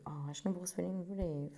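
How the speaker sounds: ordinary talk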